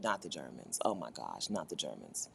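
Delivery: very low, hushed imitation; quiet, mock-appalled aside; mock dread. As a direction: drop right down to a low murmur, as if dreading it. Imitating people dreading Germans.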